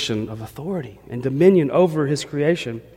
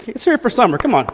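cheering on; 8 semitones higher, 3 dB louder, faster than usual. Real, not acted. Rallying the congregation.

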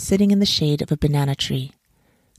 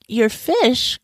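high-pitched astonished question; mildly alarmed man; startled cry; 7 semitones higher than usual. A narrator's reading. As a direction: echo it in disbelief, pitch jumping up. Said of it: "cried the man".

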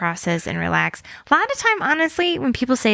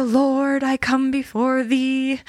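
put-on raised, slow, solemn old-fashioned prayer; formal prayer voice; 4 semitones higher, slower than usual. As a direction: address the Lord like a humble courtier. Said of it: "You're not like".

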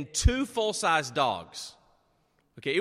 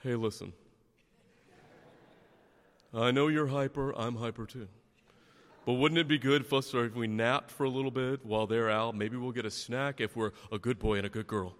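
a hint of a sleepy, scheming pet; imagined dog voice; 4 semitones lower than usual. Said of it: "did my dog turn to the other dog and go".

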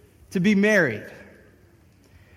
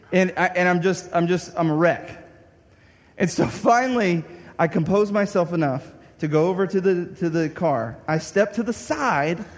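normal talking voice

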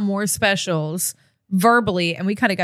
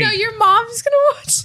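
high teasing voice; 14 semitones higher, 4 dB louder than usual. Pretend.

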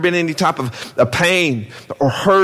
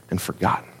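trailing off into a whisper; fading whisper; 10 semitones lower, 9 dB quieter, faster than usual. Acted. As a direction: let it die away to a whisper. Preacher fades into silence.

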